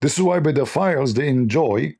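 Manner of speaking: usual speaking voice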